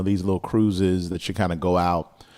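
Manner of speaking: ordinary talk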